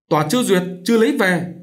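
high, loud protest; arguing back. A narrator's reading. Vợ cãi lại chồng.

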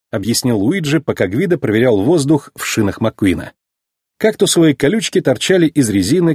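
ordinary delivery

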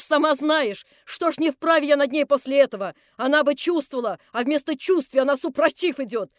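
put-on loud, wailing tirade of a drunk shrew; drunk landlady ranting. Drunk landlady complaining to the neighbours, loud and wailing. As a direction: rant like a drunken shrew working up a crowd.

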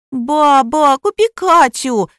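put-on high, loud, drawn-out child's wail; little girl yelling. A narrator's reading. Granddaughter begs grandmother for doll, high slow yell. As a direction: wail slowly like a begging five-year-old. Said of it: "завопила девчушка".

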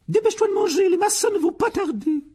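very high, loud snapping order; shrill curt woman; curt aunt. A narrator's reading. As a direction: hurry him along impatiently. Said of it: "dit sèchement la tante Pétunia".

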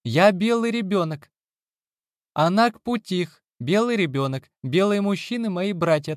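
put-on high, childish and proud; a small girl insisting; little girl voice. A narrator's reading. Child character Nina speaks.

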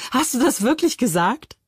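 höher, spöttisch lachende Frage; ungläubig auflachen und nachhaken; lachend spöttisch. Acted. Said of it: "lachte Moritz". (Moritz verspottet seine Schwester lachend)

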